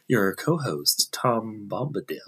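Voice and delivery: slow, low, soft radio announcer. Pretend. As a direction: croon it like a late-night AM host. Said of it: "in the A M radio voice".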